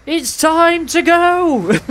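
very high, slow, stretched-out holler; high battle cry. Real, not acted. Gamer chasing a kill, high drawn-out battle cry. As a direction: sing it out high and hold the end.

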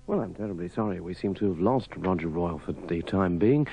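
ordinary delivery

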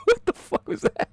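high laughing question. Real, not acted. Watching the film and cracking up, laughing question. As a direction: laugh it out in disbelief.

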